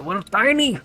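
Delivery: put-on high squeaky dwarf with a Mexican accent; silly high Mexican-accented dwarf whine; mock Mexican accent. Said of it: "I just sounded so Mexican there".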